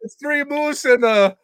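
loud, high put-on Canadian accent; hammed-up Canadian caricature. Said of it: "how I get into my Canadian accent".

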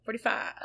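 "Forty-five" as a quick catchphrase impression; imitating the teacher. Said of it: "the way he said it, he was like".

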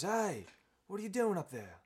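loud, high crocodile shout; calling up. Acted. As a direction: holler up at someone in a tree. Crocodile yells up the tree.

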